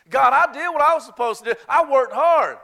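loud, high, indignant whining at God; mock self-righteous churchgoer; entitled complaint to God. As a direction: demand your due from God, hammed up. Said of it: "feel entitled".

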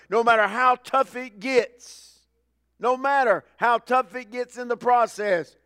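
loud, high, slow exhortation; preaching climax. Acted. Preacher urging the church to keep going, loud. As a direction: lift the church with it.

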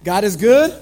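loud call, rising at the end; call to congregation. Real, not acted. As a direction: call it out to the room.

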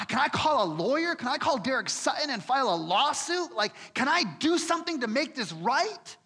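fuming over being wronged; preacher voicing Paul out for revenge; indignant inner rant. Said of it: "I'm in prison that night thinking".

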